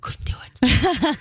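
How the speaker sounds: breathless whisper of refusal; hushed can't-do-it. Retold or imitated. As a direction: whisper it, horrified. Recalling frog dissection disgust.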